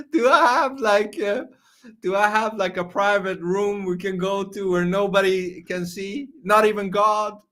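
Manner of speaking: high giggly voice; giggling. Real, not acted. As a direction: squeak it out giggling. Giggly pleading question.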